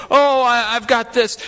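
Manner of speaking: a touch of swagger; cocky boast. Retold or imitated. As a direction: lampoon someone sure they can save themselves. Mocking self-reliant boast.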